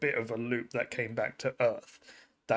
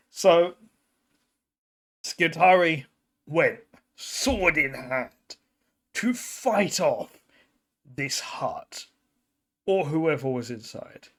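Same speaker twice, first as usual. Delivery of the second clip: slow, weighty phrases with long suspenseful pauses; dramatic storytelling; 3 dB louder than usual. Acted. Storyteller revealing who was inside the hut, dramatic storytelling.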